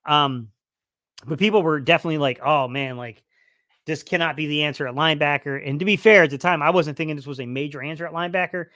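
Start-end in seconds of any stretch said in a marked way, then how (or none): none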